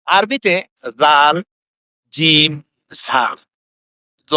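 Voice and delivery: each Arabic letter জাল, জিম, ঝা drawn out slowly; slow letter demo. Shown as a demonstration. Preacher sounding out Arabic letters to the crowd, slow and deliberate. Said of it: "এ কতগুলি অক্ষর আছে".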